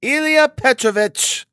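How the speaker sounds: high, pointed naming; meaningful warning. A narrator's reading. Head clerk warning his superior, pointed. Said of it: "repeated significantly".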